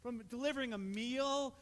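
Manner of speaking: loud, fervent sermon climax; preaching at full force. Acted. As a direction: drive the message home to the congregation.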